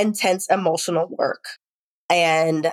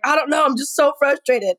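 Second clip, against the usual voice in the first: higher-pitched, re-enacted exasperation; frustrated; 7 semitones higher than usual. Mother answering her concerned son, mildly exasperated. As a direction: imitate yourself fed up, voice pushed up. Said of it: "I was so annoyed by something".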